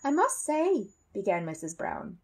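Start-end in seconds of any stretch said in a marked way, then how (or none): 0.0-0.9 higher, lightly voiced mother of the house; a lightly voiced motherly lady; Mrs Brown's voice. A narrator's reading. Mother tells the family before the dentist, lightly voiced.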